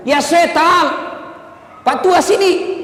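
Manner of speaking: shouting him off, high and loud; a gruff chase-off shout at the old man; angry shout. Retold or imitated. Shooing the old man away. (mengusir dengan teriakan marah)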